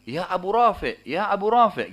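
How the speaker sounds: retold call into a dark room. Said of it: "menggunakan suara, saya bilang".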